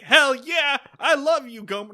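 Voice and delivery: high, loud overjoyed shout; hammed-up gushing yes; mock eager yell. Thelma Lou answering Gomer's proposal, hammed-up eager shout. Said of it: "she's like".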